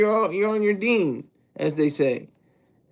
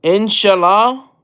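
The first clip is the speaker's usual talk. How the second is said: "Inshallah" slow and louder, rising at the end; questioning; 3 semitones higher, 6 dB louder, slower than usual. Real, not acted. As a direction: sound out "Inshallah" as an unsure question. Sounding out an unfamiliar word from chat.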